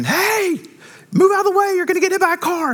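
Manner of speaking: high, hammed-up yell of warning; mock scream. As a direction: shout it like a street warning. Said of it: "you're screaming".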